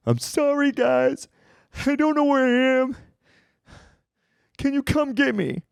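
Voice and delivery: high, whiny, teary plea; imitating his lost, tearful self, pitched high; crying on the phone. Lost and drunk, calling friends for help, teary and high. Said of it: "I call my friends crying".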